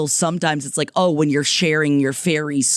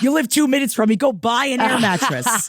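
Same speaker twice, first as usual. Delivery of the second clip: pitched higher, fed up; exasperated scolding; 7 semitones higher than usual. Retold or imitated. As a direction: tell him off with pointed irritation. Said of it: "And then I was like".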